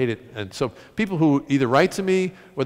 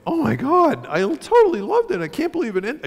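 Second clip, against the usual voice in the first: high, excited fan gush; 13 semitones higher than usual. Retold or imitated. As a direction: imitate a thrilled reader, hammed up. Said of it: "people either come up to me and go".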